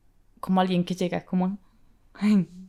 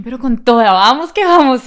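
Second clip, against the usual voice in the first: rallying cheer; 3 semitones higher, 11 dB louder than usual. Retold or imitated. Sad worker rallies herself to keep going, loud cheer. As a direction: push it out like a pep talk to yourself.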